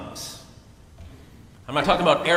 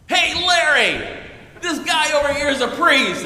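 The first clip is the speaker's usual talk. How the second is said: loud, high call across the pub; mock barroom holler to a friend; 10 semitones higher, 5 dB louder than usual. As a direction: holler to a buddy across the bar. New Yorker calls out to friend.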